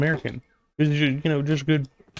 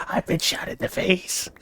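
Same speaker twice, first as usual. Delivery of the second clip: whispered, higher mock moan; breathy fake victim; 7 semitones higher, 4 dB quieter than usual. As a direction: ham up a fake dying whisper. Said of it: "she just kept saying that over and over".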